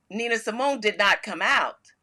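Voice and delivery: ordinary talk, nothing marked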